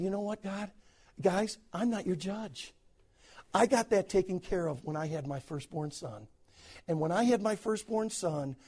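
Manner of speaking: soft surrender. Retold or imitated. Joseph to God and brothers, quietly letting go. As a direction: lay it down quietly before God.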